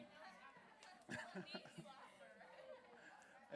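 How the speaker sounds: real high-pitched, wheezy laughing fit; uncontrollable laughter. Real, not acted. Co-host cracks up at a dad joke, uncontrollable laughter. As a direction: laugh helplessly, softly and high. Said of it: "now I can't stop laughing".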